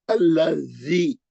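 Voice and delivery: "अल्लज़ी" slow, with the ज़ said as dh, tongue between teeth; tongue on dhaal. Shown as a demonstration. Teacher shows students the dhaal sound, slowly. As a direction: stretch "अल्लज़ी", dh with tongue tip out. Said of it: "पहले जाल को जबान लगाना".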